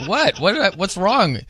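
confused surprise. Retold or imitated. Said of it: "And I'm like".

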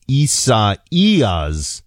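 "Isaias" slowly, as ees-ah-EE-ahs; demonstrated pronunciation. Shown as a demonstration. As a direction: stretch "Isaias" out syllable by syllable. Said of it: "Here's how to pronounce the hurricane".

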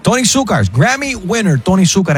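big rising host call of the name; announcer hype. Acted. Radio host hyping the headliner to listeners, excited. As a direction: sell the headliner like a stadium MC.

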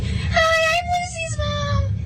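daughter mimicking mom's sky-high greeting; mock a mom's very high, drawn-out hello; exaggerated squeaky-high introduction; really high-pitched voice. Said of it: "she has, like, a really high pitched voice".

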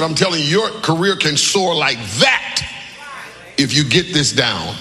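rising, shouting preacher cadence; preaching at full force. Real, not acted. Pastor shouting to the church about keeping your word. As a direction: build it up like a Sunday climax.

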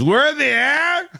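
high, startled disbelief; mock baffled drunk; incredulous. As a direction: squeak it out like he just learned he's flying. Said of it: "And the guy goes".

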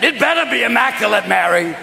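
blustering high warning; Ralph Kramden. Acted. Joseph warning Mary, blustering high husband voice. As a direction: warn the wife in exasperation, dropping at the end. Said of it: "turned Joseph into Ralph Kramden".

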